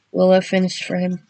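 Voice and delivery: ordinary delivery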